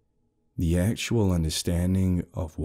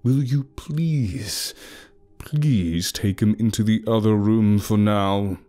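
scared apology; slower than usual. A narrator's reading. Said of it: "sudden change in his tone".

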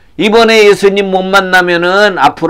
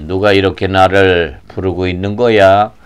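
low, hushed, measured voice; Jesus; 8 semitones lower, 4 dB quieter than usual. Acted. Jesus wondering who calls him, calm and low. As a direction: ask calmly in a deep register.

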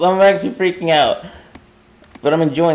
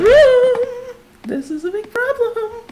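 very high, squealing panic; panicked squeal. Real, not acted. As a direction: squeal as dread takes over. Panics at trail leading out.

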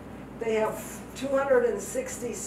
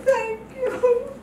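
tearful thanks; 12 semitones higher than usual. Retold or imitated. Crying on stage at award.

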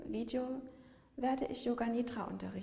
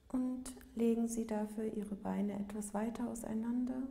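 calm yoga nidra voice, long unhurried pauses; guided meditation; 2 semitones lower, 4 dB quieter than usual. Real, not acted. Yoga teacher guiding listeners lying in Shavasana, calm meditative delivery. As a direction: lead a body scan gently, leaving space after each phrase.

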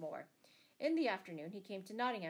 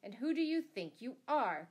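raised, higher challenge; louder, pitched-up demand to a stranger; indignant cry; 4 semitones higher, 6 dB louder than usual. A narrator's reading. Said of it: "cried the butcher".

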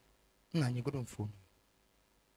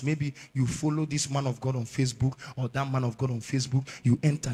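ordinary talk

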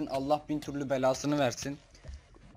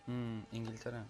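normal talking voice

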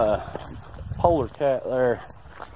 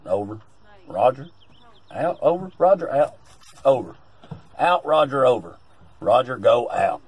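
over walkie talkie; 7 dB louder than usual. Pretend. Radio sign-off routine.

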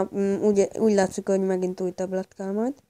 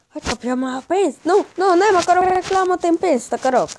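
loud, high frustrated protest; annoyed shout; 9 semitones higher, 7 dB louder than usual. Real, not acted. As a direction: yell at the ad.

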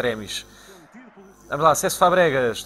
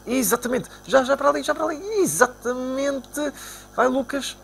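much higher, eager calls; excited; 10 semitones higher than usual. Real, not acted. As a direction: urge the pass along. Pass into space.

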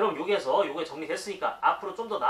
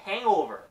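"hangover" with the weight on "hang"; "hangover", first syllable stressed and louder; 4 semitones higher, 6 dB louder, much slower than usual. Shown as a demonstration. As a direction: punch "hang" in "hangover", let "over" fall away. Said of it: "앞에 강세가 들어가죠".

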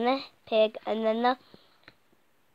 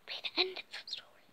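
whisper; 8 semitones higher, 13 dB quieter, faster than usual. A narrator's reading. Whispered ending.

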